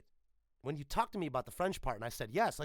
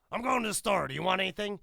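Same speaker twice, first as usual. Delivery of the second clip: lightly voiced casual offer, higher and louder; a touch of Shark; 6 semitones higher, 7 dB louder, faster than usual. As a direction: offer it breezily, a hint of Shark. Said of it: "Shark could go".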